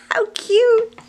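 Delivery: high, loud laughing cry of cute; laughing squeal. Real, not acted. Amused by the little pet, she laughs out cute.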